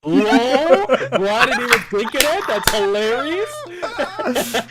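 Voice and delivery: words breaking into high laughter; cracking up mid-sentence, high and drawn out; laughing. Real, not acted. Friend laughing at a joke, cracking up while talking.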